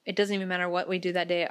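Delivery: ordinary talk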